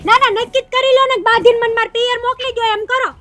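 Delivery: loud, fast, furious complaint; angry outburst. Acted. Wife lashing out at her husband, loud and fast. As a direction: blow up at him, words tumbling out.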